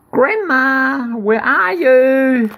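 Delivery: high, slow called-out mock shout. Girl calling for grandma in a high mock shout. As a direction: call out for Grandma, playfully high.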